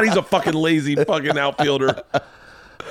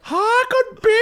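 very high, badly faked Scottish woman; botched female accent. Friend floundering as Scottish chick, very high fake accent. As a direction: squeak it in a failing accent. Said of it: "so bad with fucking accents".

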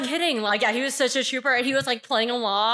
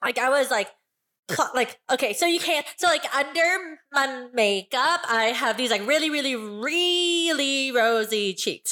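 put-on five-year-old voice; 2 semitones higher than usual. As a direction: play a chirpy child clown. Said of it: "'Cause she sounds like she's five".

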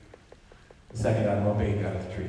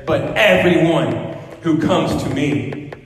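loud, slow pulpit proclamation; emphatic preaching; 5 semitones higher, 6 dB louder, much slower than usual. Acted. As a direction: drive each word home slowly. Emphatic quote of Jesus.

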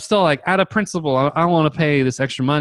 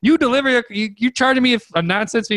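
high, sputtering indignation; freaking out; 8 semitones higher than usual. Retold or imitated. Buyer calls the fee nonsense, freaking out. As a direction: stumble over the words in retold outrage. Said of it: "I'm freaking out".

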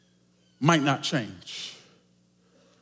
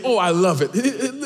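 normal talking voice

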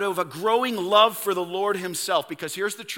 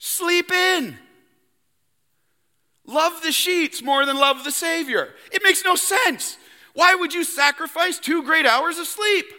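much higher, incredulous mock voice; voicing the worldly onlooker, scoffing; mocking incredulity; 8 semitones higher than usual. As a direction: scoff at getting up early. Mocking the sleepy believer.

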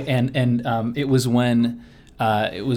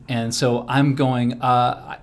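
ordinary talk, nothing marked

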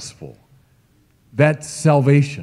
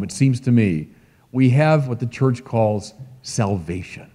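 ordinary talk, nothing marked

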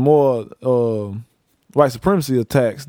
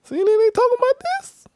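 high-pitched mocking voice; squeaky high voice. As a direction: talk it up in a squeaky voice. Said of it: "you be like".

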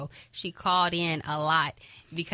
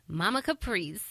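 ordinary talk, nothing marked

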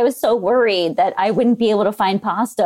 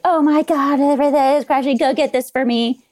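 higher-pitched mock panic; mock freaking out; 6 semitones higher than usual. Imitating people freaking out as everything crashes, mock panicked. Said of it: "the people freaking out".

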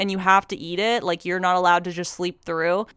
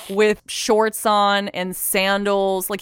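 normal talking voice

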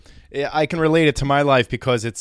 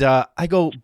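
ordinary delivery

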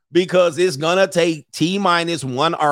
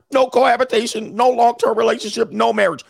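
higher, louder mock lecture; mock preachy list; 10 semitones higher, 4 dB louder than usual. Mocking the virgin's demand for serious rules. Mocks what commenter wants.

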